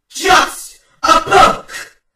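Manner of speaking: huge, high, slow raging bellow; furious roar. Acted. Twilight erupting at Caramel, furious roar. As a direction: roar it with all your rage.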